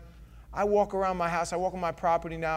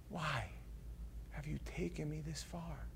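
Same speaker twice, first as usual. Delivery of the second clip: trailing hush; 16 dB quieter than usual. Acted. Pastor's repeated quiet question.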